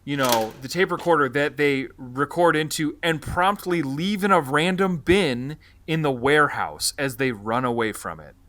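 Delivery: usual speaking voice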